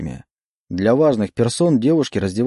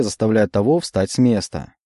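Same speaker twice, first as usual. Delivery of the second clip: ordinary delivery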